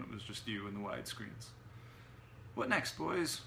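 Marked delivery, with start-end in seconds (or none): 2.5-3.4 gremlin gang talk. A narrator's reading. Hero addresses imaginary gremlins in accent.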